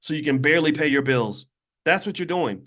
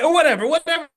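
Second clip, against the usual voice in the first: mock exasperated outburst; 11 semitones higher, 7 dB louder, faster than usual. Mocking outburst.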